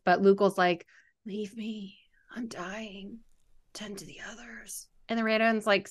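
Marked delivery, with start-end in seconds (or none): 1.2-4.9 whispered, a faint dying breath. Retold or imitated. Reader voicing the dying Lugal, whispering faintly. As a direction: whisper it as if with your last strength.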